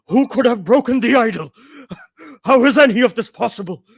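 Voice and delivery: high, loud despairing questions hurled out; anguished yelling. Acted. Chief yelling his despair at the storm, anguished shouting. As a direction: cry out in disbelief at the storm. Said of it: "yelling back".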